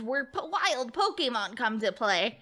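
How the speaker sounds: ordinary delivery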